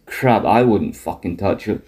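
ordinary talk, nothing marked

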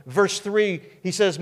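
ordinary talk, nothing marked

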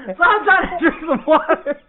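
laughing through the story; cracking up while telling it. Real, not acted. Laughing at himself to his friend.

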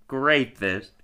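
normal talking voice

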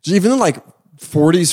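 usual speaking voice

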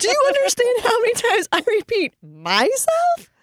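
very high, incredulous rant; hammed-up parent at wit's end; retold exasperated outburst. Mother's exasperated retort to child.

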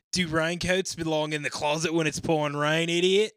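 put-on loud, high Aussie accent reading an answer; Australian accent. Reading a forum answer in an Australian accent. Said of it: "in my Down Under accent".

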